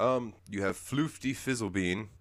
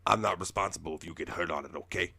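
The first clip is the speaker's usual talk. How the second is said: Danny DeVito; 3 dB louder, faster than usual. Retold or imitated. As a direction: call out like a fast-talking trainer. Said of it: "only way I can describe his voice is Danny DeVito".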